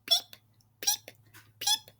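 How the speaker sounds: tiny, high chick peeps; short, dainty bird-like cheeps; animal peeping. A narrator's reading. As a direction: peep softly and squeakily. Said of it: "One of these friends says".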